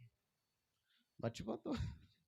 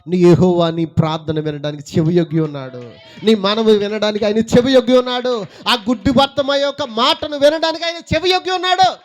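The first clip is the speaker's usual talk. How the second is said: much louder, rising preacher's proclamation; fiery preaching; 5 semitones higher, 18 dB louder than usual. Acted. Preacher assuring the congregation God listens, rising fiery proclamation. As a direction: build each line higher to a climax. Preacher builds to full force.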